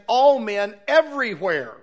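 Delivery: ALL and EVERYWHERE both stressed; double emphasis. Shown as a demonstration. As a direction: lean hard on "all" and again on "everywhere". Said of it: "Notice the double emphasis there".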